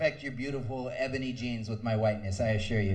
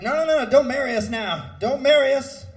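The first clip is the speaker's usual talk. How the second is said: much higher, loud preacher cry; MLK impression; 13 semitones higher, 10 dB louder than usual. Comic impersonating Martin Luther King, pleading against marriage. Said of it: "doing an M L K impression".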